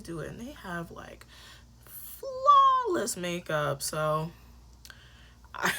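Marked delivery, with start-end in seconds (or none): 1.9-3.8 high, loud, slow gush over flawlessness; gushing emphasis. Real, not acted. Gushing to viewers about the UK girlies' makeup, emphatic. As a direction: draw out the first word, high and loud.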